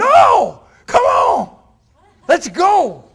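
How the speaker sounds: loud high shout; rallying shout. Real, not acted. Speaker firing up the audience, full shout. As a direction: yell to wake up the room.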